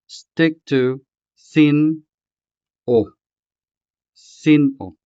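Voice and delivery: sin-o said slowly with a clear break; pause between syllables. Shown as a demonstration. Teacher urging learners to keep the break, slow sin-o. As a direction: stretch sin-o out, a stop between sin and o. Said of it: "Note the sharp pause between the two syllables".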